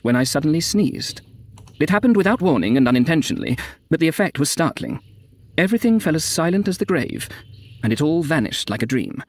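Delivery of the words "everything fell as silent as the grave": ordinary delivery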